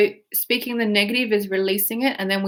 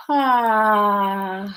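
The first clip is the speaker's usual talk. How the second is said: long voiced yawn; releasing yawn. Shown as a demonstration. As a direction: open the jaw and yawn it out. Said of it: "yawning and leaning forward".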